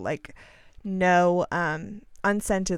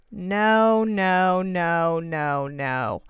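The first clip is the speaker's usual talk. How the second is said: long drawn-out no's sliding downward; dismayed refusal; 4 dB louder, much slower than usual. Real, not acted. Woman finding coconut oil on the scrub's label, dismayed. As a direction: draw out each no in mock horror, sinking.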